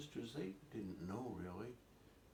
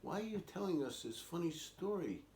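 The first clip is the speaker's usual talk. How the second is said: mildly exasperated siblings, higher and louder; lightly voiced older brothers and sisters, pitched up; 8 semitones higher, 5 dB louder than usual. Brothers and sisters to Lucy during hide and seek, mildly exasperated. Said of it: "the brothers and sisters were saying".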